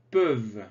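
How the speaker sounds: "Peuvent" said "peuv", with the final ENT silent; teacher models "Peuvent" as one syllable ending in v. Shown as a demonstration. Teacher showing learners "Peuvent" without its ending, said as "peuv". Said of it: "le E N T ne se prononce pas".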